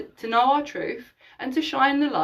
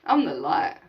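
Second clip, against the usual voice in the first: a lower, grounded repeat; lower, deeper I'm the light; 7 semitones lower than usual. Shown as a demonstration. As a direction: drop the voice low and calm for "I'm the light". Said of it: "Just go".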